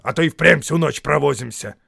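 loud, irritated troll retort; snapping back. A narrator's reading. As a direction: snap back at a nagging mate. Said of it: "огрызнулся он".

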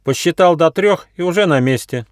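high little squirrel voice. A narrator's reading. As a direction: chirp it like a young squirrel. Squirrel character voice.

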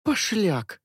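put-on tossed-off girlish jab; girl's voice. A narrator's reading. Milya's retort. (девичий голос, дразнящий)

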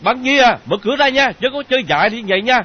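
loud, high, urgent shout; alarmed, high-pitched hollering; shouting through a door. Acted. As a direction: yell a desperate plea to open up. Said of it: "tông mạnh vào cửa phòng rồi la lên".

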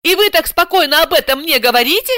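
high-pitched outraged question; be appalled at such calm; breathless indignation. A narrator's reading. Client to unruffled translator, choking with indignation. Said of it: "задохнулась от возмущения Ася".

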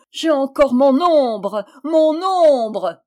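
high, loud, drawn-out cry. A narrator's reading. Little man in the street, shouting with long-held words. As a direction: call it slowly down the street, triumphant. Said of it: "éclatait de rire et criait dans la rue".

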